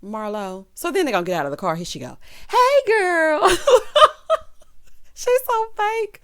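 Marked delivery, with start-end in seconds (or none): 2.5-3.5 high, loud, sugary mock greeting; mock-bubbly hey girl, pitched way up; fake sweet greeting. Mocking Marlo's fake greeting. 3.5-6.2 high squealing laugh into words; cracking up, hardly able to speak. Real, not acted. Laughing at fake greeting.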